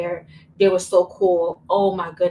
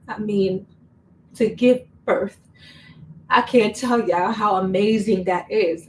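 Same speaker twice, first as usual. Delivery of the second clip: normal talking voice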